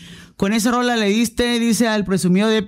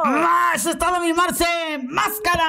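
excited shout; 9 semitones higher than usual. Real, not acted. Host cheering a caller's request on air, excited shout.

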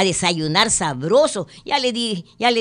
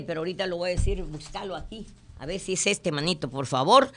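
much softer, fast aside to herself; muttering aside; 9 dB quieter, faster than usual. Real, not acted. Host searching her notes, muttering softly. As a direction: mumble while searching.

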